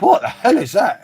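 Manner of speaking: shocked first sip. Retold or imitated. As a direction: recoil at a strange taste. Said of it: "And I was like".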